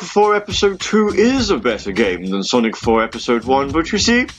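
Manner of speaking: know-it-all Sonic fan. Retold or imitated. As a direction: lecture like a smug know-it-all. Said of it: "you're the sort of person who would sit there and go".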